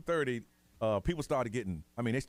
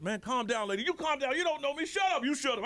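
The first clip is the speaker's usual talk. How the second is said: heated argument; 13 semitones higher than usual. Retold or imitated. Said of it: "And I said".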